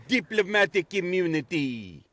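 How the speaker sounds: slow South African accent impression of the Lethal Weapon villain. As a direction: drawl "Diplomatic immunity" slowly in a South African accent, like the movie villain. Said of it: "the guy from Lethal Weapon".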